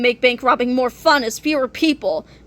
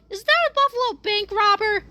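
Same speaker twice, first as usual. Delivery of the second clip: high voice; 7 semitones higher than usual. Acted. Narrator voices a bystander.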